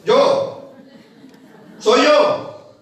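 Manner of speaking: a mock visitor calling through the door; answering at the door. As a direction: announce yourself to someone inside.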